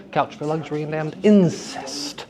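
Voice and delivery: natural stress, "incest" accented on its first syllable. Shown as a demonstration. Teacher trying moved stress, "incest" stressed at the start. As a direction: hit the "in" of "incest" after "damned". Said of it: "have to create a space between those two words".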